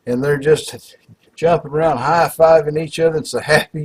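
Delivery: ordinary talk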